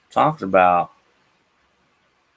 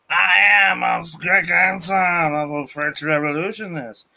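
defiant French citizen; 8 semitones higher than usual. Retold or imitated. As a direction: spit defiance at the rulers. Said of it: "And the French people are like".

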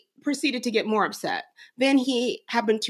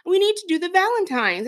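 mom voicing her son's higher pleading yell; 7 semitones higher than usual. Mom quoting son demanding valentine help, higher kid voice. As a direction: whine it like a kid yelling for his mom. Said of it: "he came into the room and he yelled".

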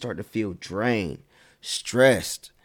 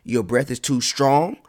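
louder, higher mock shoo; shooing them off; 4 semitones higher, 5 dB louder, slower than usual. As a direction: wave the pest away with a big mock shoo. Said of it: "tell them".

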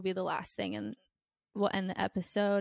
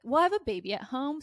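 quick, louder, higher mock excuse; blunt brush-off; 6 semitones higher, 6 dB louder, faster than usual. To the guy at the bar, a quick louder blunt brush-off. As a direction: rattle off the baby excuse to shut him down.

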